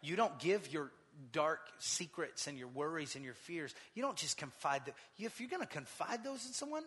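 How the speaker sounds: ordinary talk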